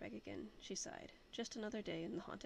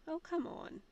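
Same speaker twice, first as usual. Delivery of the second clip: coaxing protest; 8 semitones higher, 6 dB louder, slower than usual. Acted. Allison coaxes the reluctant captain.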